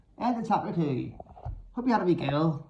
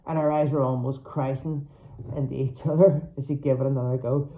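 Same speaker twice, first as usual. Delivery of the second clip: usual speaking voice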